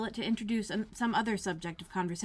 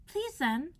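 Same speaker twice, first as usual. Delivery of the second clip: put-on much higher girlish voice; Alice asking the footman, high and polite; young Alice; 9 semitones higher than usual. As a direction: pitch up as a polite little girl. Alice character voice.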